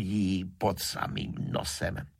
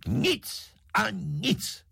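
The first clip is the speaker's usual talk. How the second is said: high, drawn-out angry outburst; slow, emphatic stubborn cry; thundering refusal; much slower than usual. A narrator's reading. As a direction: fire off two thunderclaps. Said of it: "střelil z pece dva hromy za sebou".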